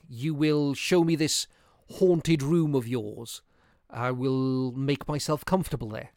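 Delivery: put-on bold young man. A narrator's reading. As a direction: speak up boldly to the old folk. Narrator speaking as himself to the old folk.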